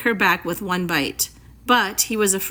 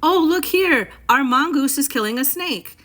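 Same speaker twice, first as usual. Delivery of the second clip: put-on high, loud child calling to the house; excited shout; 6 semitones higher, 4 dB louder than usual. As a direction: yell it out to the grown-ups. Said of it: "Teddy shouted to the house".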